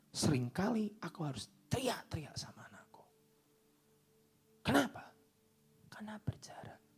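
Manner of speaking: hushed whisper for God's words; God whispering, almost breath only. Acted. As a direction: whisper it intimately, as a secret. God quoted, told in a whisper.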